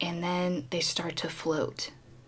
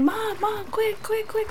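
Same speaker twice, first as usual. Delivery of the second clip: imitated boy calling out, very high-pitched; urgent excited kid. Boy calls Mom to see his find, high excited imitation. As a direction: call for Mom like an eager child.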